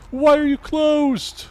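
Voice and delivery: high, loud, drawn-out mock wail. Player complaining to locked doors after hours, high mock wail. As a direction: wail it way up high, stretching the words.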